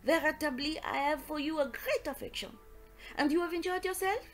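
put-on delighted, lilting French-accented detective; Poirot greeting affectionately. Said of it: "He greeted me with much affection".

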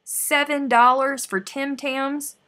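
loud, slow disbelief at the cost; shocked price outrage. Real, not acted.

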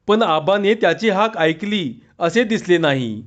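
ordinary delivery, nothing marked

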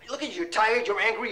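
ordinary delivery

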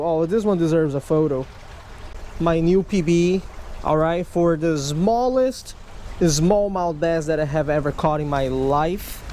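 ordinary talk, nothing marked

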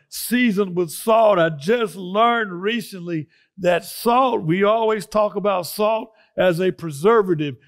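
loud, fired-up preaching, driving each phrase; full-force preaching. Real, not acted. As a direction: preach it to the congregation with fire. Preaches loudly from the letter.

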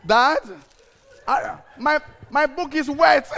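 high, loud mock child voice; child's complaint. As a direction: whine like a kid with a soaked book. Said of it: "you know, ah".

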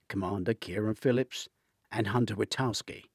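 plain reading voice

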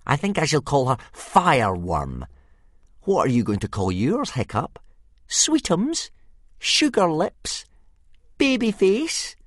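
high, loud put-on taunting voice; mocking bully. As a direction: tease with sickly pet names, high and loud. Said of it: "grinned Snotlout".

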